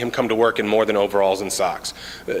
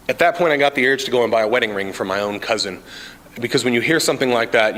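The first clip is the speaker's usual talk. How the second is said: ordinary talk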